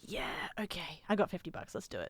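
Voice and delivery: whispering; hushed decision to buy; whispered to self. Retold or imitated.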